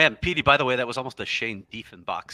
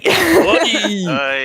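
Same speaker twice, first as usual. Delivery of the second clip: very loud, high drawn-out wail; hammed-up, wailing dismay; mock lament; 10 semitones higher, 12 dB louder than usual. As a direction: groan it out long in mock agony. Lamenting the Suns' late start.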